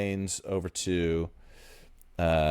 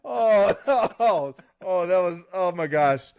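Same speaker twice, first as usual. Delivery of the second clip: delighted laughter; 10 semitones higher, 4 dB louder, slower than usual. Real, not acted. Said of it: "That might have been my favorite part".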